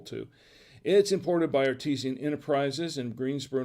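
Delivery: usual speaking voice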